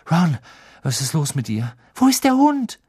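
high, loud urgent call; panicked, rushing to a friend; alarmed shout. A narrator's reading. Harry finds injured Ron. (alarmierter Ruf)

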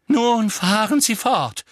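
shrill-edged loud and high, slow and pressed; a teacher near hysteria, high and loud; loud, high, hysterical. A narrator's reading. Trelawney snaps at the class, loud, high and hysterical. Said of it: "mit lauter, hoher und leicht hysterischer Stimme".

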